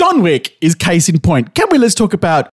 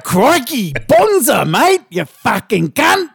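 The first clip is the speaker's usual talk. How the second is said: a hammed-up Australian accent, higher; exaggerated Australian accent; 6 semitones higher, slower than usual. Cohost ribbing his partner in an over-the-top Australian accent. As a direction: overdo the Australian accent for laughs. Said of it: "my stupid, over-exaggerated Australian accent".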